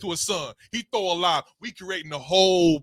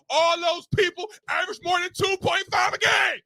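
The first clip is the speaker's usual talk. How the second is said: heated yelling; 10 semitones higher than usual. Real, not acted. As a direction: yell it, fired up. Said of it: "[name] done lost his voice and got all emotional".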